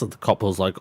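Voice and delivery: normal talking voice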